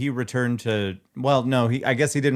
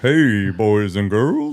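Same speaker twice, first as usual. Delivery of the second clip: deep Santa voice; 3 dB louder, much slower than usual. Pretend. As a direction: greet kids slowly like a crooning Santa.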